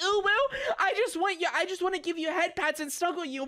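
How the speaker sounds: mock a smothering fan; mocking cutesy voice. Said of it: "where you're like".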